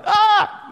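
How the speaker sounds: a sudden high, loud startled "Ah!" breaking into a laugh; mock scream. Preacher acts out scaring disciples, shrieking laughing scream. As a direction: shriek it like a jump scare, then laugh. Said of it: "I would have gone".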